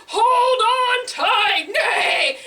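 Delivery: high, drawn-out call ending in a whinny; horse calling out. Acted. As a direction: warn your riders, then neigh. Horse warns riders before galloping.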